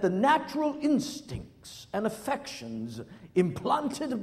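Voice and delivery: slow, solemn English archbishop voice; imitating an English accent. Pastor mimics the archbishop's wedding vows in an English accent. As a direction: intone it like an English cleric at a royal wedding. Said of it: "he says this in this English accent".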